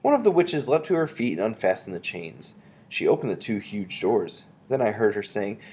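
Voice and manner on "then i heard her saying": ordinary delivery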